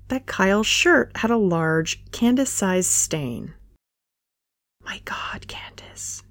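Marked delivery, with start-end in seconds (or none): 4.8-6.2 hushed breathless whisper; inner thought. A narrator's reading. Candace whispers to herself in horror at the drool. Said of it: "she thought".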